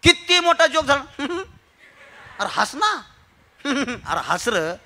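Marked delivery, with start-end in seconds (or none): 1.2-3.0 slow, forced chuckle around the words; mock laughter. Speaker mimicking people who won't laugh at a joke, mock chuckling. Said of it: "हसत नाहीत sir लोक". 3.7-4.7 slow, low, forced chuckle; mock reluctant laugh. Speaker imitating people who do not laugh, mock chuckling. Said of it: "हे जे असे हसत नाहीत".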